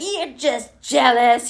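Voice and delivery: high, drawn-out mock taunt. Hungover employee to boss, high drawn-out mock taunt. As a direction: stretch it out like a bratty comeback. Imitates own reply to boss.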